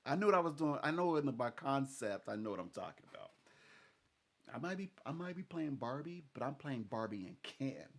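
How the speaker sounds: ordinary delivery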